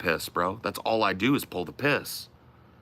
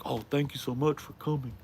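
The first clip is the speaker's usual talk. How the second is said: higher, softer gushing thanks; mock grateful streamer; 5 semitones higher, 7 dB quieter than usual. Streamer mocking others who thank rude visitors for coming, put-on gushing. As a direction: imitate a fawning streamer. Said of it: "so many fucking streamers are like".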